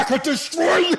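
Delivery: high, loud hammed-up villain; teasing the angry teen, mock villain boast; mock villain threat. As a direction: roar it like a cartoon supervillain. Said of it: "He's like".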